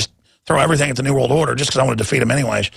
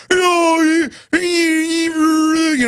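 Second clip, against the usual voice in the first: mock Klan guy; 13 semitones higher, much slower than usual. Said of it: "some Klan guy going".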